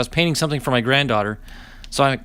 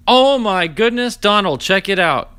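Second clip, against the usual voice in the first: much higher and louder, stretched slowly; amazed; 6 semitones higher, 5 dB louder, slower than usual. Real, not acted. Calling Donald over to see a big pull, amazed. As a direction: marvel out loud at a huge pull.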